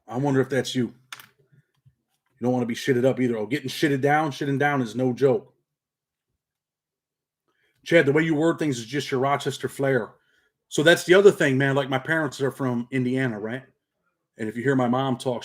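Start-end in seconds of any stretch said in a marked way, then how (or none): none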